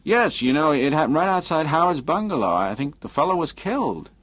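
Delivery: lightly voiced Adams. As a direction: lightly play a talkative man, voice lifted.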